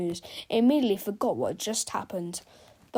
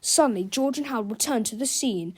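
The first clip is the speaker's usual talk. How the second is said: ordinary delivery, nothing marked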